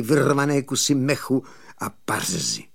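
ordinary delivery, nothing marked

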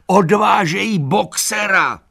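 high, loud, frantic donkey voice; frantic yell to the animals; alarmed shout. A narrator's reading. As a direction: scream the warning. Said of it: "křičel".

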